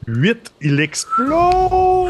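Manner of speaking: high, loud, drawn-out cheer; excited shout. Real, not acted. Player seeing the die explode, real excited shout. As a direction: stretch the last word into an elated yell.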